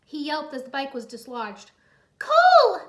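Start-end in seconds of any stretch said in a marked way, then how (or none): 2.2-2.8 very high, loud scream of his name; alarmed cry. Acted. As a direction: shriek in alarm. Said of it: "Irene cried".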